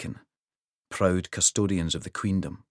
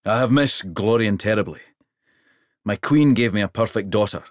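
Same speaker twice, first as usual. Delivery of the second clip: put-on louder, deep rural Hrothy accent; proud father king in rural Hrothy accent; king boasting of his daughter, rural Hrothy accent; 3 semitones higher, 6 dB louder than usual. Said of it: "He spoke Hrothy with a deep rural accent".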